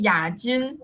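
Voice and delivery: "Yajun" said as "yah-jin", lifting at the end; native name pronunciation. Shown as a demonstration. Presenter telling the host what to call them: "Yajun" as "yah-jin", with a rise. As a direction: give "Yajun" clearly with a hard "j", rising on the last syllable. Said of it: "How do you pronounce it?".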